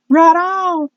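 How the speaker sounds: very high, drawn-out cheer; high excited whoop of approval; celebrating. Real, not acted. Cheering the signature on show.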